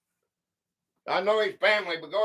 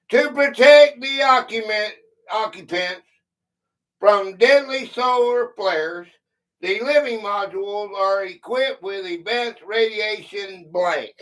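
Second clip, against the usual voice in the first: reading a quiz clue; 4 semitones higher, 5 dB louder than usual. Acted. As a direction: clear read-aloud quiz voice. Reads a quiz clue aloud.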